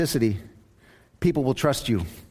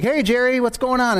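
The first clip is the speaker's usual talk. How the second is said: fake cheerful greeting; 8 semitones higher than usual. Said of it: "I'm like".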